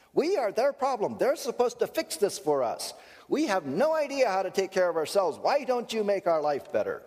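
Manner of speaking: put-on high childish whine; helpless whining adults. Hurricane victims whining that government must fix things. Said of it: "Sorry for getting emphatic".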